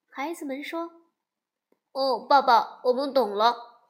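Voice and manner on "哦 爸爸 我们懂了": a hint of contrite cubs. 孩子们认错.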